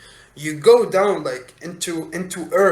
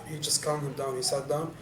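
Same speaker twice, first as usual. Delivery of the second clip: usual speaking voice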